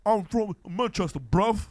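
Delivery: loud, high Mancunian lad voice; jeering Manchester impression, pitched high and loud; mock Manchester accent. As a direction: sneer it like a cocky Manchester lad. Mocking British lads online.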